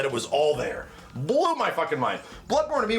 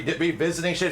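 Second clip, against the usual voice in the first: normal talking voice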